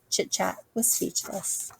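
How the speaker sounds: soft hushed whisper. A narrator's reading. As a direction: breathe it out in a whisper. Hushed narration of punchline.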